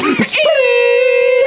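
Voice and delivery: very high laughing squeal imitating a ringing sound; high-pitched ringing. Telling friends about the chaos, high squealing ringing noise. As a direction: squeal up high like ear ringing after a blast. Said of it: "high-pitched sound from Saving Private Ryan".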